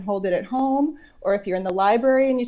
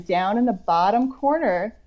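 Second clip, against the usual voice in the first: ordinary talk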